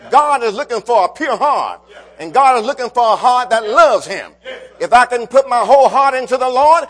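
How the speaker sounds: loud, rising preacher's cadence; fervent loud preaching. Acted. Preacher rousing his congregation, loud fervent preaching. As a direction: preach it out to the back pews.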